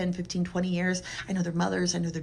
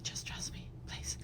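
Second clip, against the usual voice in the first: hushed whisper; whispering a diagnosis to a tearful patient; 15 dB quieter than usual. Retold or imitated. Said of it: "I'm like".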